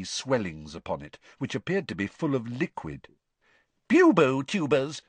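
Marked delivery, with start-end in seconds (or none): 3.9-5.0 high, loud, brisk teacher voice; bright no-nonsense herbology teacher; brisk Professor Sprout. A narrator's reading. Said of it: "Professor Sprout told them briskly".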